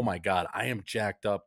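ordinary talk, nothing marked

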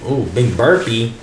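ordinary talk, nothing marked

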